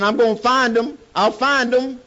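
high, loud panicked reply; frantic promise. Retold or imitated. Charlie yells back to the wounded man, high panicked shout. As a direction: shout it back to calm him. Frantic answer amid battlefield screaming.

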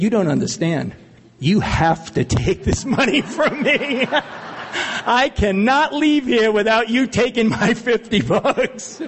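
laughing, pleading to pay back the money; high, laughing plea to take his fifty bucks; laughing insistence. Retold or imitated. Man begging a clerk to take his money, laughing.

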